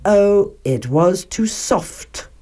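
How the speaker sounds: ordinary delivery, nothing marked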